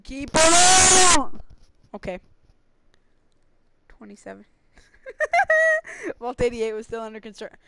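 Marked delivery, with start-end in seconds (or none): 0.0-1.4 slow, loud, high shout; loud drawn-out yell. Real, not acted. Urging someone on, louder. 5.1-6.2 short high-pitched laugh. Real, not acted.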